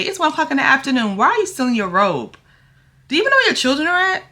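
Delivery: fast, high, scolding rebuke; scolding sister. Acted. Rehearsing her line scolding a lazy sister, fast and high. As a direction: lay into her like a fed-up sister. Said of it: "I gotta say".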